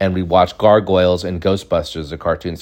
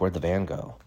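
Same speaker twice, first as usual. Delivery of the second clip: quiet puzzled question; 2 semitones lower, 11 dB quieter than usual. Retold or imitated. Kid finds the van gone, quiet puzzled question. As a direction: ask it under your voice, thrown away. First take, before explaining pun.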